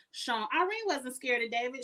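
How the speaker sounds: ordinary talk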